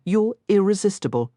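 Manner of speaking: plain reading voice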